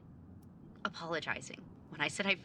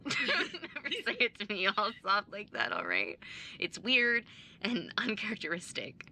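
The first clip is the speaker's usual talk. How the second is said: louder, laughing through the words; laughing tease; 6 semitones higher, 6 dB louder than usual. Acted. Sister laughing off her brother's tenderness.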